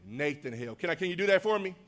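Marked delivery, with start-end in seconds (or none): 0.1-0.8 call-and-response. Shown as a demonstration. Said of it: "you say".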